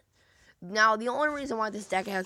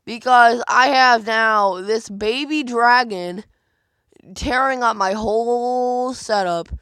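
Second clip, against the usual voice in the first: much louder and slower, dismay over the damage; loud dismayed excitement; 2 semitones higher, 9 dB louder, slower than usual. Real, not acted. Gamer telling viewers his defence is collapsing, loud slow dismay. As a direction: belt it out as the setup gets torn up. Losing to the baby dragon.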